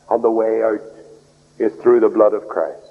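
normal talking voice

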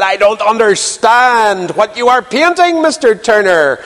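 high, slow, drawn-out lady's voice; hammed-up lady, stretching the words slowly; puzzled lady. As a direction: imitate a baffled lady, pitched well up. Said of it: "A lady once appro-, approached Turner".

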